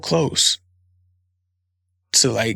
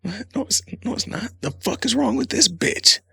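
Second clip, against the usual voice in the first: incredulous inner voice; 10 semitones higher, 3 dB quieter than usual. Retold or imitated. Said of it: "I'm thinking".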